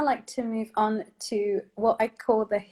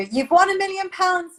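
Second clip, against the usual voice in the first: excited cue; 8 semitones higher, 8 dB louder than usual. Shown as a demonstration. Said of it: "act really surprised for me".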